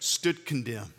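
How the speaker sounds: ordinary talk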